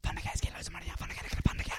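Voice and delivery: hushed repeat of the street call; sly voiceless whisper; suspicious whisper. Acted. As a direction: whisper the chant back. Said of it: "a suspicious whisper whistle".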